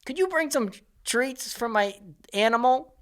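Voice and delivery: mock the entitled dog owner asking front desk; whiny mock guest request; needy guest. Mocking needy hotel guest.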